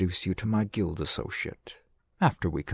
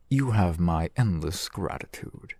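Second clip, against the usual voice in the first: normal talking voice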